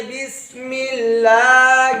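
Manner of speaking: बिस्मिल्लाह slowly, with a light thin "l" and long "aa"; thin lam. Shown as a demonstration. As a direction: keep the ll of बिस्मिल्लाह light, drawn out syllable by syllable. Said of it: "लाम को बारीक करके पढ़ेंगे". (बिस्मिल्लाह का लाम बारीक, खींचकर)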